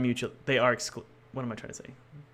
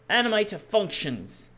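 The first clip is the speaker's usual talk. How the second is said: drawn-out, higher announcer voice on "Animator Functions"; grand announcement; 9 semitones higher than usual. Pretend. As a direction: announce "Animator Functions" slowly like a movie title. Announcing the script name grandly.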